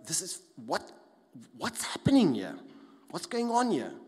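overwhelmed confusion. Pretend. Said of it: "you go like".

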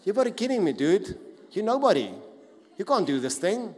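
loud mocking jeers; jeering mockery. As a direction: sneer at him like a bully. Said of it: "the whole scene was a sense of".

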